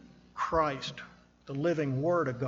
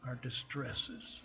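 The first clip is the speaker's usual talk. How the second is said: tender appeal; 2 semitones lower, 10 dB quieter than usual. Real, not acted. Pastor's tender closing appeal.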